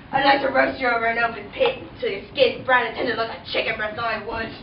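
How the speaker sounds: low Cockney leer; lightly Cockney prince. Acted. The prince leering at Cinderella, Cockney accent. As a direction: leer at her like a London charmer.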